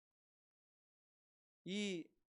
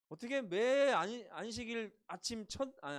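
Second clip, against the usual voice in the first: ordinary talk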